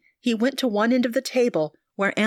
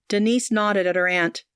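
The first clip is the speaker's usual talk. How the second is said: plain reading voice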